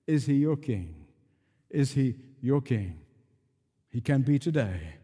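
ordinary talk, nothing marked

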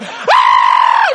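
loud imitation of an overjoyed shriek; crazed mock scream. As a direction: scream like the waitress losing it. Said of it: "She just went crazy".

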